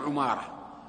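عمارة said ʕumāra, u after the ayn; عمارة as "ʕu-MAA-ra"; damma on the ayn. Shown as a demonstration. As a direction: open عمارة with a rounded "ʕu". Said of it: "يقول بضم العين".

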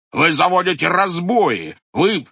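loud, indignant scolding; furious head. A narrator's reading. Head berating the watchmen, loud and indignant. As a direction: berate the disobedient men.

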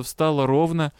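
usual speaking voice

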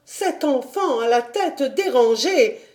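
horrified exclamation. A narrator's reading. Governess aghast at the child's outburst, crying out. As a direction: cry out in scandalized horror. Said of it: "s'écria mademoiselle Rottenmeier".

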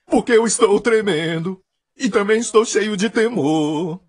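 drawn-out, exaggerated quavering preacher; mock fearful trembling. Preacher mimicking Paul in fear and trembling, hammed-up quaver. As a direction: ham up a man quaking with holy dread. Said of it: "não quer dizer que ele estava assim".

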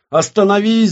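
high, loud cry to stop; screaming. Acted. Witch orders the reflection to stop, screaming. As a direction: scream it as a desperate command. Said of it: "закричала ведьма".

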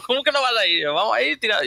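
high, pushy teasing; high mock scolding. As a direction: boss him into going, voice way up. (regañando en broma con voz muy aguda)

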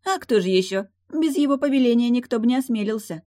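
high, loud mermaid voice; matter-of-fact mermaid. Acted. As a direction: answer as if obvious, pitched high. Mermaid answers the obvious.

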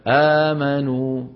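آمنوا read slowly with a long first aa; slow model reading. Shown as a demonstration. Teacher reciting the answer slowly to the students. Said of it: "شو بيقولوا؟".

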